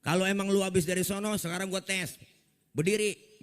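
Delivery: taunting challenge. Acted. Abu Jahal menantang Nabi.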